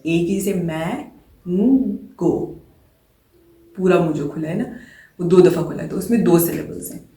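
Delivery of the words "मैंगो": मैंगो stretched out with मैं held before गो; slow two-part मैंगो to show both vowels; split into syllables. Shown as a demonstration. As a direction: draw out each syllable of मैंगो slowly. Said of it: "दो दफ़ा vowel की sound निकालने के लिए खुला है".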